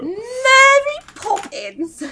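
put-on very high, drawn-out old British lady; old lady British accent. Shown as a demonstration. Showing co-hosts a British role, high old lady voice. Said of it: "mostly play very old ladies".

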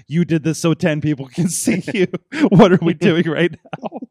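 laughing through the words; cracking up mid-sentence. Retold or imitated. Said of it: "we're like".

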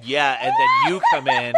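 high, loud, slow laughing shout; laughing outburst. Real, not acted. Dad to daughter teasing her, loud laughing shout.

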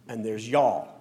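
Southern American accent on "y'all", drawn out; lightly Southern "y'all". Shown as a demonstration. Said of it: "I use my Southern vernacular".

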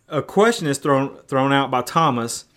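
usual speaking voice

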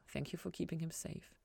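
hushed private thanks; silent prayer, hushed. A narrator's reading. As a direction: murmur it to yourself in thanks. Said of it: "Ella thought".

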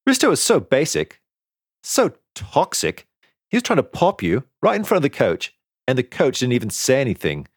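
put-on high-pitched, outraged young teammate; indignant friend. A narrator's reading. Azalea indignant about the attack.

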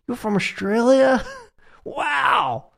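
put-on high, slow New York accent with a laugh; imitating an amazed New Yorker in a New York accent. A New Yorker meeting an Australian, in a New York accent. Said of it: "I can't even do the New York accent".

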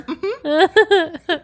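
squeaky, high, laughing mm-hmm; hammed-up high agreeing hum; high mock mm-hmm. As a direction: chirp a silly high mm-hmm. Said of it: "she's like".

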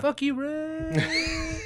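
drawn-out mock curse. Quoting a show line.

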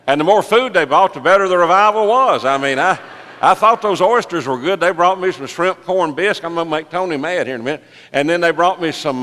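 high, loud, full-force sermon storytelling; excited preaching. Acted. Preacher telling church about the surprise at Drago's, excited preaching. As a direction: belt out the testimony with rising excitement.

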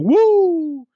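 high-pitched, loud drawn-out "woo"; Ric Flair woo. Retold or imitated. Co-host imitating wrestler in a viral gif, high loud whoop. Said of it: "Ric Flair just going".

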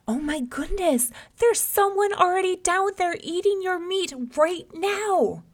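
high, loud, shocked outcry; feigned alarm. A narrator's reading. Rabbit tricks lion at the well, alarmed outcry. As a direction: sound the alarm to goad someone into action.

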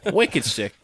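put-on Boston slang voice; tossed-off Boston impersonation; mock Boston accent. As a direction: play up a Boston accent for a joke. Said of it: "You sound like a Boston person".

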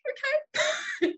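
a very high, squeaked okay; squeaky mock okay. Told the spider isn't that big, a very high squeaked okay. Mother's resigned reply.

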